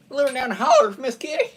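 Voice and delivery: high, fast, laughing through the line; Goonie Bird laughing. Retold or imitated. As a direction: giggle through the drunk bird bit. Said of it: "I think it was holler".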